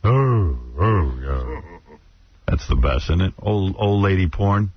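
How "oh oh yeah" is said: mock moan. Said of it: "This is what body heat would sound like".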